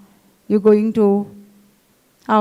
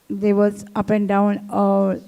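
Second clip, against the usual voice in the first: ordinary talk, nothing marked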